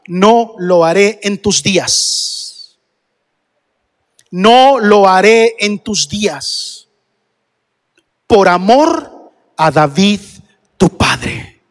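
very slow, weighty scripture reading; solemn pronouncement. Acted. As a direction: let each word land as a divine verdict. Reads God's verdict to Solomon. (sentencia solemne, muy lenta)

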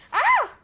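sudden high loud yelp; startled yelp. Real, not acted. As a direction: yelp in shock. Shot at in game.

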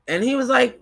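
ordinary talk

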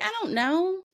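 lightly mocking not having a clue; clueless shrug. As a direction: toss it off with a high shrug. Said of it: "I'm like".

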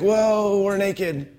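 high, sheepish mock admission; sheepish confession. Adam and Eve owning up to God, sheepish.

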